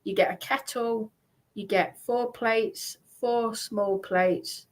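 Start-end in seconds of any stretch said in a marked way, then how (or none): none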